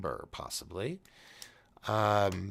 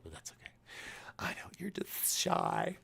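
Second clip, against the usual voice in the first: whisper; 8 semitones higher, 7 dB quieter than usual. Real, not acted. Teasing shy viewers who didn't call.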